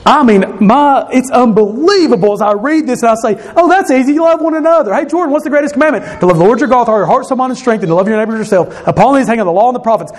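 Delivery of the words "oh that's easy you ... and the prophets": high, quick, breezy rattling-off; acted know-it-all reciting by rote; glib recital. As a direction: reel off familiar verses like it's easy. Glib recital of familiar verses.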